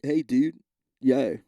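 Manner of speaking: bad Pittsburgh accent. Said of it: "I don't know the Pittsburgh accent".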